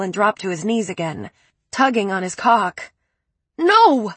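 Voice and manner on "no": much higher, loud sharp scream. A narrator's reading. Narrator screaming at Preppy, frantic. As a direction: scream to stop him. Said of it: "I screamed".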